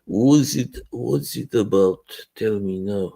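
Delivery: lightly voiced Putin with a Russian accent. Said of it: "I'm now Putin".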